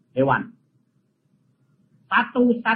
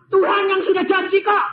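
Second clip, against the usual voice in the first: retold protest to God; 10 semitones higher than usual. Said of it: "Tapi saya bilang".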